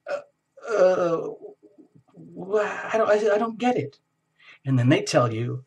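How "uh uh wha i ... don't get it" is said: flustered confusion. Retold or imitated.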